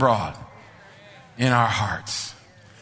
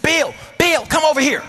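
calling out; 10 semitones higher than usual. Retold or imitated. Calling out from a distance.

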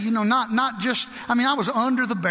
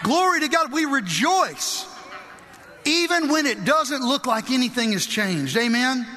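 high-pitched, driving exhortation; impassioned pulpit declaration; fervent preaching; 5 semitones higher than usual. Real, not acted. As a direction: hammer each reason with conviction. Preaches praise at full force.

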